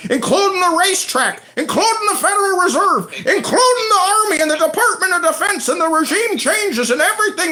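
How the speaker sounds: high, loud hammed-up Irish accent; mock leprechaun voice. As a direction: squeal it like a panicky leprechaun. Mocking the Irish leprechaun caller.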